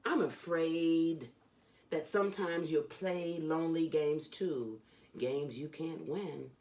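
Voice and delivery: lively, sing-song reading of a rhyming picture book; animated children's book read-aloud; storybook reading. Acted. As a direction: read it like a bedtime story for a kid. Reads Dr. Seuss aloud.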